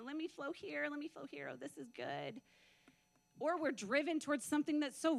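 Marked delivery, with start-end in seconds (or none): 0.0-2.4 mock blissful drifting. Mimics drifting with the wind.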